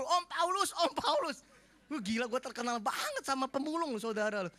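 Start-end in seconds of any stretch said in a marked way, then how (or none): 0.0-1.4 higher eager call; kids calling out. Retold or imitated. Street kids calling to the pastor in the food court: higher eager call. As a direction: call out to Uncle Paulus like a street kid.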